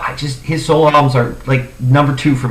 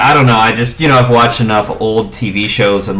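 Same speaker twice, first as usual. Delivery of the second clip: usual speaking voice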